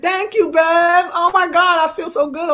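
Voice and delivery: high-pitched, gushing gratitude; excited, high-voiced outburst of appreciation; delighted thanks. Real, not acted. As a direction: squeal out your thanks, overjoyed.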